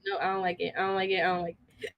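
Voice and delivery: low, quiet squeamish repeat. Retold or imitated. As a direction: mutter it with a shudder. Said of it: "I was like".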